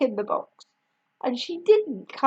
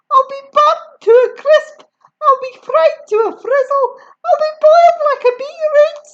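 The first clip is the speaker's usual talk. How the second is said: very high, loud indignant yell; 9 dB louder than usual. A narrator's reading. Grandma yelling at George, high loud yell. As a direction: snap back furious. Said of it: "she yelled".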